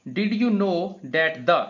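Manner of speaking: slow dictation; slow reading. Shown as a demonstration. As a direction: read it slowly to the class. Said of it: "अब आते हैं हम third की तरफ".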